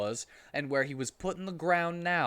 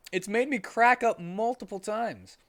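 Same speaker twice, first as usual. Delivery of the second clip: ordinary delivery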